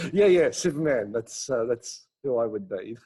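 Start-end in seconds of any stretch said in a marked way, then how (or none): 0.0-1.1 laughing. Real, not acted. Guest laughs at Superman compliment.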